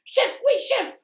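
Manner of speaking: high, loud soldier reply; soldier shout. Acted. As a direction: snap to attention and shout. Soldier answers roll call.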